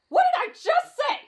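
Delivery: high, exasperated outburst; frantic scolding. Acted. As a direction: scold in rising panic. Scolds friend touching fog.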